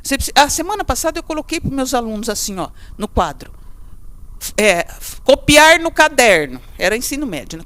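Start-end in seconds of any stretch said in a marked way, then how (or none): 5.2-6.6 slow, loud "copiar no caderno"; firm dictation. Shown as a demonstration. Teacher reading the board instruction to students, slow and loud. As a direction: spell out the instruction slowly. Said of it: "teacher dictates the board instruction".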